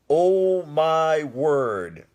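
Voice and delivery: loud, high gasp of delight; overwhelmed by the spread; amazed. Real, not acted. Seeing the beautiful buffets.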